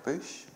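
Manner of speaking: "bush" repeated with a fronted oo; Northern Irish "bush". Shown as a demonstration. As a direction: say "bush" Northern-style, like "boosh". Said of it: "I have to say Moses and the burning bush".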